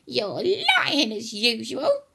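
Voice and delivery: loud, high, accusing granny; scornful old-woman shout; yelling grandma. Acted. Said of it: "Grandma yelled".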